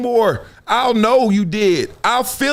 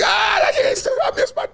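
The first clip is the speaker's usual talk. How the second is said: a hammed-up cry and shout, pitch flying up; mock wailing. Grieving relative at the funeral, hammed-up wailing cry. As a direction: wail like a mourner losing it at the funeral. Acting a mourner falling out.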